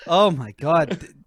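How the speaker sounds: normal talking voice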